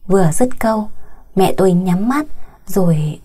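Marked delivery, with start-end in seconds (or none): none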